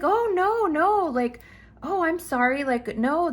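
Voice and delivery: high flustered backpedal; nervous fidgeting neighbor. Retold or imitated. Neighbor backpedals after being called out, nervous and high. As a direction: apologize in a jumpy rush, voice up. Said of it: "he's kind of fidgeting".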